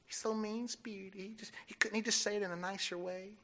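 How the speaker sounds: soft, whiny mock complaint; softly mocking the critics. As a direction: imitate the sniping church member under your breath. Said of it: "What I'm saying is we".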